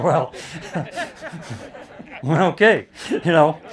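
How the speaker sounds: laughing okay. Retold or imitated. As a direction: laugh it out in relief. Caddie laughing at the great shot.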